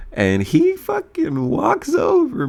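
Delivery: ordinary delivery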